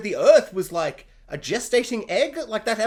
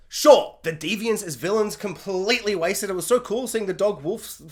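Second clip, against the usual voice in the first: ordinary talk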